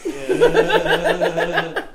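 loud high laughing yeah. Real, not acted. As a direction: laugh through it. Laughing at joke.

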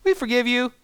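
put-on high, breezy kid voice; cheerful kids. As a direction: toss it off like carefree children. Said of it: "They're always like".

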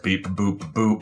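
soft robot bleeps. Acted. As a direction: say it like a little robot. Robot bear speaks.

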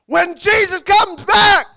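loud, high, slow proclamation; triumphant shout. Real, not acted. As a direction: shout it out word by word to the congregation. Preacher proclaims the second coming.

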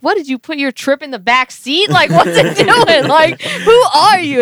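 high, loud, slow, laughing; stunned disbelief. Retold or imitated. Amazed at boyfriend driving the mountain perfectly, high laughing disbelief. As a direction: gasp it out in giggling amazement. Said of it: "And I was like".

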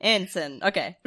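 flat synthetic voice; robot voice. Retold or imitated. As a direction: copy the robot pronunciation guide. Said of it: "I was just repeating the robot voice".

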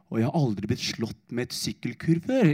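a stunned inner voice as the blow lands. Retold or imitated. Slow-motion inner thought.

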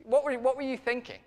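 hammed-up maternal reproach, pitched high; exasperated mum. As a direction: imitate a mother wailing at her son in disbelief. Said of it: "my mum calls me [name]. She'd say".

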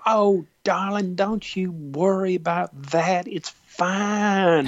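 high, drawn-out Southern "darling" voice; soothing Southern belle; Junie's Southern accent. Retold or imitated. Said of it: "she has this wonderful Southern accent".

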